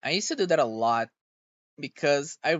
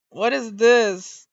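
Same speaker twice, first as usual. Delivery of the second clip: slow, loud and higher, stretched out; exasperated disbelief; 6 semitones higher, 6 dB louder, much slower than usual. Real, not acted. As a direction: drag it out in baffled outrage. Baffled at glitching model.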